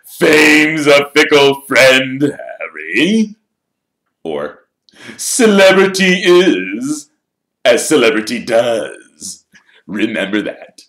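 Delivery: slow, high and loud showman's voice; vain Lockhart. Acted. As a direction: preen like a celebrity signing photos.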